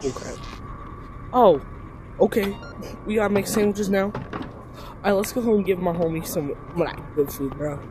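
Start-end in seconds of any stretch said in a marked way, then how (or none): none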